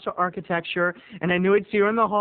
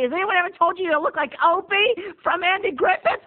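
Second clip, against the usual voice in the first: gushing mother; 13 semitones higher than usual. Retold or imitated. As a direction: fuss over him like a gushing mom. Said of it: "her mother was like".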